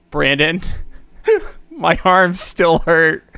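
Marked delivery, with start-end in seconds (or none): 1.3-3.4 laughing through the words. Real, not acted. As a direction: giggle while saying it. Laughing about sore arms.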